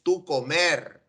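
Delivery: "tú comer" said slowly, as the mistake; slow, pointed "tú comer"; slow wrong form. Shown as a demonstration. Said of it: "Tú no dices".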